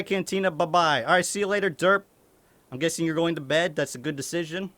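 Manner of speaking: ordinary talk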